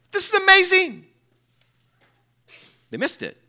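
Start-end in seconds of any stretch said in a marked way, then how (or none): 0.1-1.1 much higher, loud cry of wonder; soaring preacher's exclamation; exclaiming awe. Real, not acted. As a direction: ring out the amazement.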